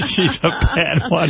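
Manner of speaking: quoting through a laugh, slow; giggling as you repeat the caller's line; laughing imitation. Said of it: "he goes".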